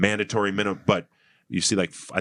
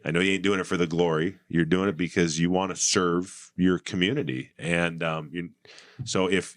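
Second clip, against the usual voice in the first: ordinary talk, nothing marked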